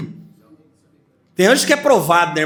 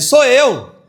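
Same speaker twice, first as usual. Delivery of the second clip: higher and louder as Peter; Peter's voice; 8 semitones higher, 5 dB louder than usual. Acted. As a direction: announce yourself at the door. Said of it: "com a voz dele".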